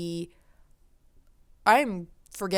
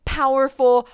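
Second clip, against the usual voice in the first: usual speaking voice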